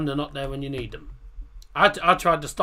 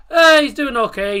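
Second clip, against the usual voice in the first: loud; 11 semitones higher, 13 dB louder than usual. Real, not acted. Sudden loud answer.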